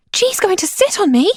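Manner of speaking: a mock panicked yelp from the trunk; panicked cry. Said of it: "Abeka cried, voice panicked".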